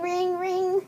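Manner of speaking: high, sustained ringing; phone ring imitation. Storyteller acting out the phone, high imitated ring.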